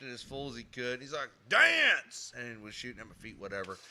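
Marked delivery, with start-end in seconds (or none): 1.5-2.3 loud, high bark; taunting command. Retold or imitated. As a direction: order them to dance like an outlaw. Brother shooting at feet.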